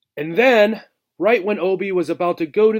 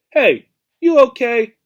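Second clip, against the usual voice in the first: put-on higher, louder old mouse checking in; gruff old mouse asking with concern; 9 semitones higher, 8 dB louder than usual. Old mouse seeing Obi about to faint, asking with concern. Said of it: "with a look of concern".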